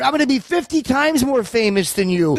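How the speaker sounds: high, insistent mock boast; retold movie boast. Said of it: "like De Niro was telling fucking Jerry".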